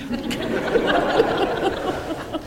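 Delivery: quiet breathy chuckle; soft laugh. Real, not acted. Chuckling at his own near-death joke, soft laugh. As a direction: let a soft laugh slip out.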